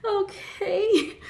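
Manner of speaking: high, drawn-out disbelieving okay; incredulous okay. Real, not acted. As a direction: stretch it up in disbelief. Reacting to creepy plot.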